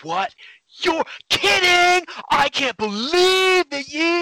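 high, slow, loud gushing; excited gossip. Acted. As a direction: gush like a gossiping friend. Acted gossip on phone.

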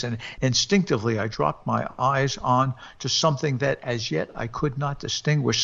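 ordinary delivery

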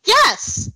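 high, punchy yes; emphatic yes. Real, not acted. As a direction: snap out a firm yes. Answering own question emphatically.

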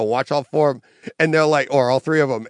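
ordinary delivery

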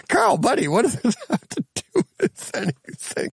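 high-pitched, helpless laughing plea; laughing exasperation. Real, not acted. Host teasing buddy about his off-topic comment, laughing exasperation. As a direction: plead with a buddy while cracking up.

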